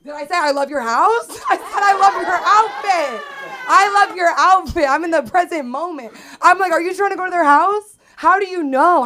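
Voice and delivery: high, loud comic exasperation; indignant retort. Acted. Woman sets straight the friend who pulled her aside, indignant. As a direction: snap back in loud, insistent disbelief.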